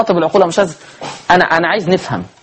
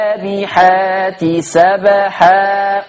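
slow melodic tajweed recitation; Quran recitation; 2 semitones higher, much slower than usual. Shown as a demonstration. Teacher recites Surat an-Naziat for students. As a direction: chant each verse slowly.

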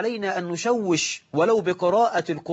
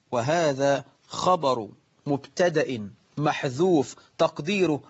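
normal talking voice

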